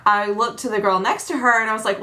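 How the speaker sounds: ordinary delivery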